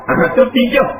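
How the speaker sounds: ordinary talk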